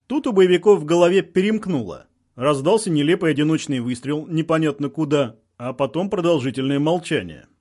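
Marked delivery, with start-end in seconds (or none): none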